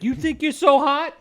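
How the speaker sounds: high-pitched put-on accent taunt; Rose accent impersonation. Game character challenging the player, in a put-on accent. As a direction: challenge them in an imitated, exaggerated accent. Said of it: "I was doing my impersonation of Rose".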